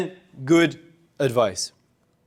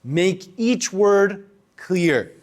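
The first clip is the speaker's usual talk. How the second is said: very slow, a beat between each word; slow with pauses; much slower than usual. Shown as a demonstration. Teacher showing pausing during speech, very slow. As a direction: space out each word deliberately. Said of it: "I pause during it".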